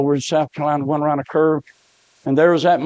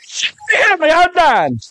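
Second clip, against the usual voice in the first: high, loud cry for help; desperate plea; 14 semitones higher, 4 dB louder than usual. Retold or imitated. Preacher voices a dying man's last plea, desperate screaming. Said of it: "here's what he said".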